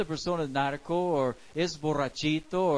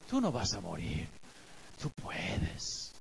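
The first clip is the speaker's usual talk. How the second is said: much lower, fading into a sly whisper; serpent voice; 11 semitones lower, 9 dB quieter than usual. Acted. Serpent coaxes the woman to disobey, low and whispering. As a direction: tempt her low and hushed like the serpent. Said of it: "y dice".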